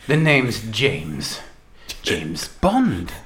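low, slow suave Bond delivery; low, slow Bond line, going down at the end; James Bond line. Shown as a demonstration. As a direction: drop the pitch and draw out the name slowly. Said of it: "I'm gonna go".

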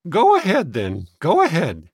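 resigned. A narrator's reading. Sister giving boys grudging consent, resigned. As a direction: give in crossly, in a fussy woman's voice. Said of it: "said Miss Applegate with a sigh".

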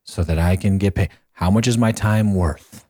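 ordinary delivery

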